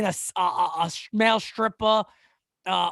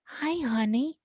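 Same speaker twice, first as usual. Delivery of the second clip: mock phone sex voice; 4 semitones higher, 5 dB quieter, slower than usual. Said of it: "my voice would have been terrible for that".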